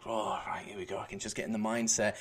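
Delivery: soft, low mumbling to himself; quiet. Retold or imitated. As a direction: murmur it quietly. Voicing Cody settling in.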